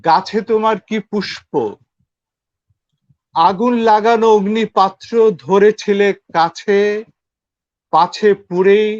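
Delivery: measured, projected verse with pauses; poetry recitation. Acted. Guest recites a Bengali poem.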